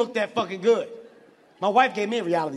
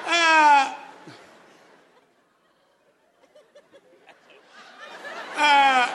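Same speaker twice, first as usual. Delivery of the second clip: loud, raised, drawn-out "uh"; stuck "uh"; 7 semitones higher, 7 dB louder than usual. Acted. Man tongue-tied before gorgeous woman, loud stuck "uh". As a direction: stall loudly, unable to find words. Lost for words before her.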